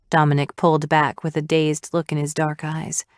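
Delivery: ordinary delivery, nothing marked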